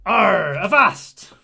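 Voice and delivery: loud hammed-up pirate talk. Streamer playing pirate for raiders, loud hammy pirate voice. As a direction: bellow like a stage pirate. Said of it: "We all be talking like pirates".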